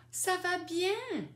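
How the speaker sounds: very high, drawn-out reply; joyful answer. Shown as a demonstration. Grandmother replying that she is well, joyfully high. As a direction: sing out the answer high and happy. Models her happy answer.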